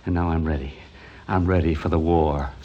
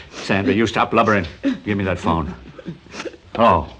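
normal talking voice

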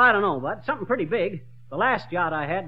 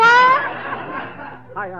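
a startled yelp of embarrassment; flustered squeak; 8 dB louder than usual. Acted. Flustered customer squeaking at the shopkeeper's question. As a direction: voice cracks high and loud.

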